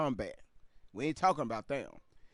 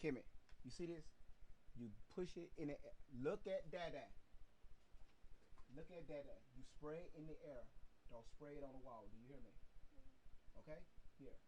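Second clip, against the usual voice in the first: hushed coaching; 20 dB quieter, faster than usual. Real, not acted. Gently coaching child with spray.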